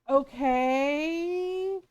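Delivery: long drawn-out, rising okay; bemused acceptance. Retold or imitated. Parent handed an open stick of butter, bemused. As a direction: stretch the word out in baffled resignation.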